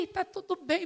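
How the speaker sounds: ordinary talk, nothing marked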